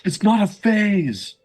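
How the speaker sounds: put-on high, slow whiny teen. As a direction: whine it like an emo kid. Emo teen parody.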